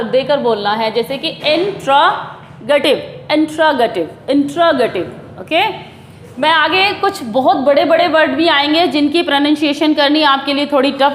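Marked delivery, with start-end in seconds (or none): 1.3-5.0 "interrogative" in deliberate chunks, in-tra-ga-tiv; "interrogative" split and stressed, falling at the end; stressed syllables. Shown as a demonstration. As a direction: press on each part of "interrogative". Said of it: "जोर डालकर".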